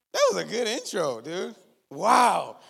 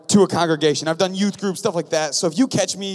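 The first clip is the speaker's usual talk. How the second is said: usual speaking voice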